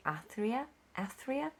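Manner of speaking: "Athria?" tried out with a questioning rise; "Athria" lifted at the end like a question; unsure pronunciation. Shown as a demonstration. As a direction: sound out "Athria?" twice, unsure of it. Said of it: "This is how I've been pronouncing it".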